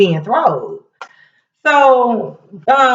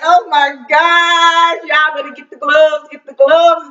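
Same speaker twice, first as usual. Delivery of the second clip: excited exclamation; 6 semitones higher than usual. Retold or imitated. Narrator reacts to rising fight.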